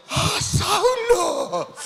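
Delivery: high-pitched, drawn-out mock cry of fright; gasp, then wail the name in comic dread; hammed-up terror. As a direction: play panicked horror at who just appeared. Ananías acted terrified of Saulo.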